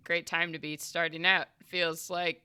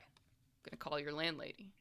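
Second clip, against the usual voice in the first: whispering; 13 dB quieter than usual. Acted. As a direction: say it under your breath. Frank muttering.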